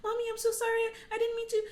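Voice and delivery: high, loud imitation of a little girl; apologetic child. Daughter apologizing to Mom for a spill, high frantic child voice. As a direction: plead like a distraught child, squeaked up high. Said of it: "And she's like".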